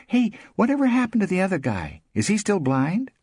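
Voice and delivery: high, quick, curious woman's voice; Tiger, chatty party guest. A narrator's reading. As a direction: pipe up high and fast with gossip.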